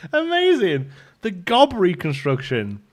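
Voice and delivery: high, loud, delighted exclamation; savouring the silly phrase, gleeful. Real, not acted. Streamer reacting to a silly chat message, gleeful.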